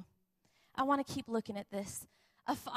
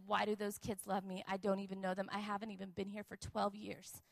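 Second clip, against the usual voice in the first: crying father; 4 semitones lower, 5 dB quieter than usual. Retold or imitated. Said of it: "he starts to cry".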